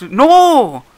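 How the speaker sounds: loud, high cry of protest; sudden high yell, drawn out; dismayed shout. Real, not acted. Upset at game mishap.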